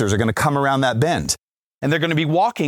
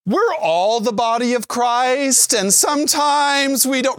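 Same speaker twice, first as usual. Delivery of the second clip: slow, much higher, sing-song reassurance; soothing youth minister; 9 semitones higher, slower than usual. Retold or imitated. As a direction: placate the kids like an overly sweet youth leader. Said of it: "I was like".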